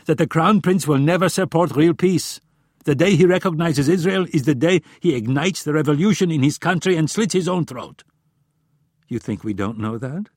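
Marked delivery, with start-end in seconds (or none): 0.1-8.0 loud, high-pitched angry argument; spat out. A narrator's reading. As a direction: argue it hotly, voice raised. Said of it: "spat Friedman".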